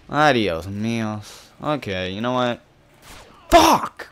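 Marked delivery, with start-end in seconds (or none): 3.5-4.1 sudden high, loud curse; frustrated outburst. Real, not acted. Gamer cursing after stunning himself, frustrated outburst. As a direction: burst out with a high-pitched angry swear. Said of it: "sorry about this. I'm not getting mad".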